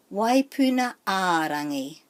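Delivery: "waipuna a rangi" with the middle a held long; stress on A. Shown as a demonstration. Teacher saying the whole name, long stressed a in "waipuna a rangi". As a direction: lean on the lone a in "waipuna a rangi". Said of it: "So the emphasis is on a".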